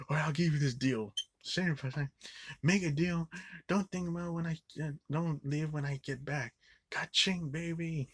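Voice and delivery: high, hyped closer voice; mock salesman yelling. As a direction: sell it like a sleazy dealer. Salesman yells coming back.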